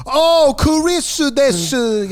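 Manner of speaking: very high, loud, drawn-out mock Japanese; over-the-top Japanese accent. Shown as a demonstration. Friend showing what not to do, over-the-top Japanese accent. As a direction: caricature Japanese accent, slow and shrieky high. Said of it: "You don't have to go".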